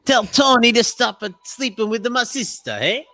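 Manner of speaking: hammed-up Brooklyn mobster in an Italian New York accent. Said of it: "It's an Italian New York from Brooklyn".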